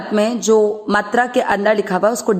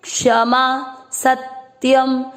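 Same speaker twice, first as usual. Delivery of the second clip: recitation; 3 semitones higher, much slower than usual. Shown as a demonstration. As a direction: chant both lines together slowly. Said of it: "पहला और दूसरा चरण साथ में". (धीमा ऊँचा श्लोक पाठ)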